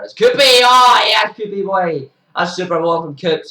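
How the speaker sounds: high, loud cheer for the wicket; real joy, shouting the bowler's name; excited shout. Real, not acted. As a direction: yell it out as the catch is taken. Wicket celebration by commentator.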